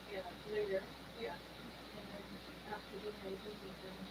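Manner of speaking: very soft, off-mic phone talk; quiet phone call. Real, not acted. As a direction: murmur to the caller so the stream barely hears. Quiet phone call while streaming.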